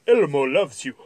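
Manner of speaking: loud toy doll voice; talking doll. Acted. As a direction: play it like a pull-string toy. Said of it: "said the doll in a high-pitched voice".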